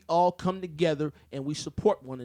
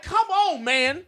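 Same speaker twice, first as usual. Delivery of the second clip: exasperated; 12 semitones higher, 4 dB louder, much slower than usual. Retold or imitated. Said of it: "I'm like".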